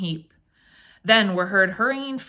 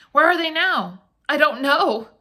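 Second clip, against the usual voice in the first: frightened; 7 semitones higher, 5 dB louder than usual. Acted. Frightened questions.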